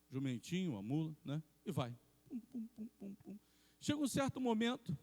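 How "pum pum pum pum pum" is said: whispered mule hoofbeats; plodding sound effect. Retold or imitated. Narrator imitating the mule going down the road, whispered plodding. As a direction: softly puff out steady little hoof thumps.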